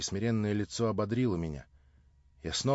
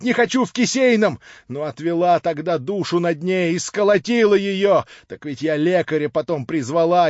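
higher, louder shrewish tirade to the neighbours; loud, higher, aggrieved harridan voice; ranting drunk landlady; 12 semitones higher, 12 dB louder than usual. Acted. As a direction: harangue the crowd like a spiteful drunk shrew. Drunk landlady rants, beats girl.

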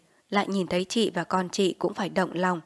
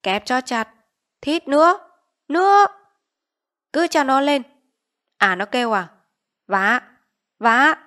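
shouts climbing higher and louder to a scream; chief's rising yell; 6 semitones higher, 9 dB louder than usual. Acted. Village chief urging guards to squeeze tighter, yelling higher. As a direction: egg the torturers on, ever higher. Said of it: "Lý Trường vẫn dồn dập thét".

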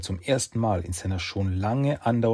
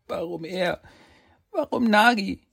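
high, breaking cry of grief; anguished cry; 12 semitones higher, 3 dB louder than usual. Acted. Grieving Saki asks the fox why, crying out high. Boy mourns his brother.

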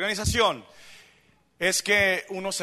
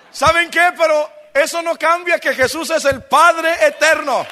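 loud, high, emphatic preacher's shout; fiery pulpit delivery; full-force preaching; 7 semitones higher, 10 dB louder, slower than usual. Real, not acted. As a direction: proclaim it to the congregation, stretching key words.

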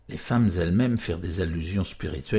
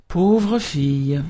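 loud, high, drawn-out show of pity; hammed-up sighing commiseration; mock-sorrowful lament; 7 semitones higher, 8 dB louder, much slower than usual. A narrator's reading. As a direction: wail it slowly with feigned sadness. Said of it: "un air triste pour soupirer".

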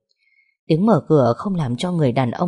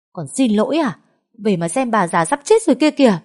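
scolding; 6 semitones higher, 3 dB louder than usual. A narrator's reading. Scolding the returned woman.

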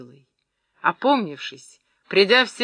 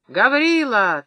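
loud, higher call for Гаврила; narrated shout; 5 semitones higher, 5 dB louder, slower than usual. A narrator's reading. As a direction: call a man in from the doorway. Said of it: "тотчас крикнул стоявший у дверей Сажен".

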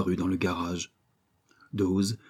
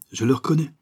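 higher, louder cry of recognition; exclaiming; 6 semitones higher, 5 dB louder, faster than usual. A narrator's reading. Second crook recognising the prisoner, exclaiming. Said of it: "s'écria le second individu".

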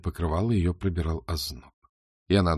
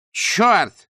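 high, loud sharp curse; exclamation; 11 semitones higher, 7 dB louder than usual. Acted. Beryl recognising her captor, loud sharp curse. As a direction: blurt it out in shock. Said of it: "воскликнула Берил".

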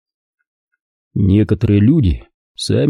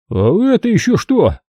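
exclaiming; 10 semitones higher than usual. A narrator's reading. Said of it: "воскликнул один из них".

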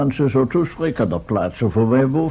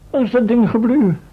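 higher and louder, alarmed and fussing; agitated question; 8 semitones higher, 4 dB louder, faster than usual. Acted. Professor searching for his missing recorder, agitated. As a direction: demand to know where it went.

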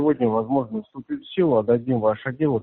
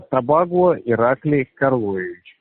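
slow, clearly spelled-out name; slower than usual. Real, not acted. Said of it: "Чётко, пожалуйста".

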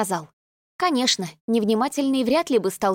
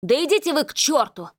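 an enraged yell, voice pushed higher; furious shout; 5 semitones higher than usual. Acted. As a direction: snap it out in fury. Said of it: "в бешенстве крикнул Манин".

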